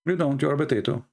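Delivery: ordinary delivery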